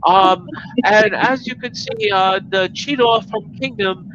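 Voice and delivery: high sneering reviewer voice; mock a smug reviewer, pitched high; mocking impression. High sneering voice imitating a smug rival reviewer. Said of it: "He's like".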